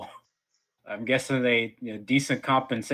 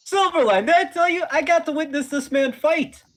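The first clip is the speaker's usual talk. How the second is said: high, eager voice; excited telling; 4 dB louder than usual. Acted. Student excitedly telling his master about the fight. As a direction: bubble over with news.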